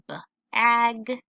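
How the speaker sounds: b-ag-gy stretched slow by syllable; sounding out. Shown as a demonstration. Teacher blending a word for the class, slowly. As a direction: drag out b-ag-gy part by part. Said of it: "add the last bit to it".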